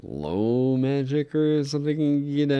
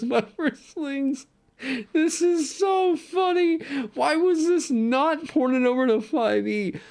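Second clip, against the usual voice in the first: much higher, giddy, laughing squeal; delighted squeal; slower than usual. Real, not acted. Streamer gushing to chat about the shriek rock, high squealing delight. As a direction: squeal with real glee over a goofy item. Gushing over a silly item.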